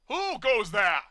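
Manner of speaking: loud haughty challenge; snobbish. A narrator's reading. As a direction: look down your nose at them. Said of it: "his voice all snobbish".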